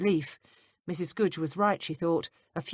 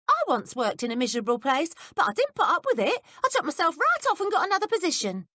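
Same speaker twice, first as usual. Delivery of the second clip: put-on higher, curious young maid; higher maid's voice, prying; 12 semitones higher, 4 dB louder than usual. A narrator's reading. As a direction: play the nosy young maid, pitched up. Betsy voiced by reader.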